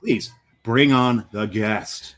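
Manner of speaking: slow, pleading please bring on the guest; mock-pleading slow request; drawn-out plea. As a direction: stretch it out like a begging request.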